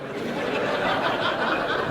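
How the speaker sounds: breathy laugh at his own joke. Real, not acted. Speaker amused by the terrorist roles, breathy laugh. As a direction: let a quiet laugh escape.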